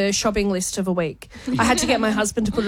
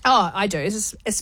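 ordinary talk

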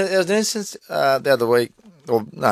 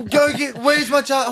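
put-on fussing child; 8 semitones higher than usual. Imitates son fussing over lost charger.

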